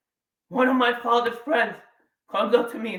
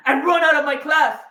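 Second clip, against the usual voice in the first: ordinary talk, nothing marked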